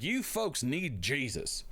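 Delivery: slow mock Southern preacher; Southern accent. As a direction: draw it out in a put-on Southern accent. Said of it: "don't say it with a Southern accent".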